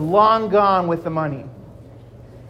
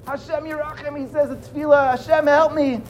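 higher, hushed plea; desperate prayer; 7 semitones higher, 4 dB quieter than usual. Acted. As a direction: beg softly to God. Victim prays in despair.

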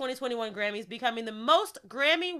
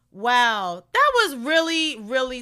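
touched wow; 8 dB louder, slower than usual. Real, not acted. Moved by Tiffany's speech.

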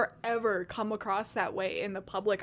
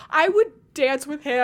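eager fan; 5 semitones higher, 8 dB louder than usual. Retold or imitated. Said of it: "And I'm like".

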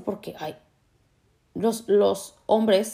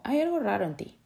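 higher, softer, suspicious put-on voice; mock suspicious; 6 semitones higher, 4 dB quieter than usual. Mocking a relentlessly positive person, in a suspicious put-on voice.

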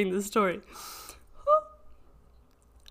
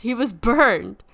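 anguished; 6 dB louder than usual. Acted. Caller stresses son burned.

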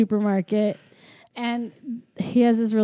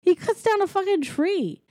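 much higher, insistent disbelief; incredulous protest; 8 semitones higher than usual. Real, not acted. Podcaster insists on the eight-year-old's feat, incredulous protest. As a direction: object in high-pitched amazement. Protests co-host's minimizing correction.

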